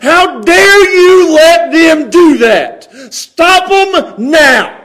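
loud, high, drawn-out furious demand; red-faced anger. Acted. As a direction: roar it slowly, outraged at his nerve. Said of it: "they're, they're red-faced".

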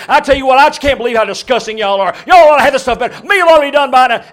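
hammed-up angry shout, high and very loud; barking. Preacher mimics a man ordering his wife and daughter about, barking. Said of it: "he starts barking at his wife and daughter".

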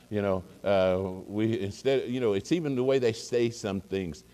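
ordinary talk, nothing marked

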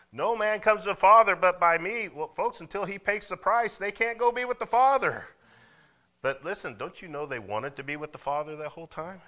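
loud, high-pitched impassioned sermon; full-force preaching. Real, not acted. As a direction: preach it out at full cry to the congregation.

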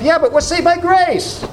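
high, mock-naive voice of an excuse; self-justifying objection. Preacher voicing his own excuse, mock-naive and high. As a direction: pitch it up like a lame excuse. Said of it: "I say to myself".

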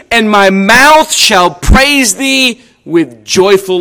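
scripture reading. Acted. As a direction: read the psalm with reverent power. Reads psalm aloud in service.

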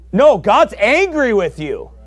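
forceful rebuttal. Real, not acted. Preacher correcting the soft claim, loud and slow. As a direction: hammer the correction home. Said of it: "contrasts the soft view".

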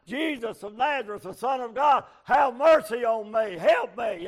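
loud pleading cry for mercy. A narrator's reading. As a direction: beg Jesus at the top of your voice. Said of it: "he began to cry out".

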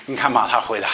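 normal talking voice

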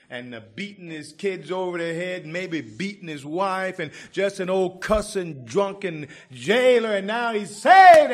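climbing to a shouted, high revival cry; preaching at full force. Acted. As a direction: preach it hotter and hotter until you're shouting.

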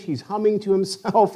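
ordinary talk

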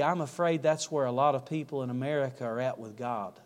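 low, soft preaching with long pauses; solemn appeal. Real, not acted. Preacher urges listeners to know God before prayer, hushed and solemn.

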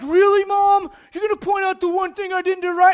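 put-on high, loud, indignant teenager; whiny teen comeback. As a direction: push back loud and offended at mom. Kid talks back to mom.

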